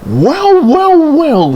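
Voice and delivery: very high, loud mock astonishment; amazed exclamation. A narrator's reading. Percy watches Thomas shoot off, high amazed exclamation. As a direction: exclaim each word, pitch soaring. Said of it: "he exclaimed".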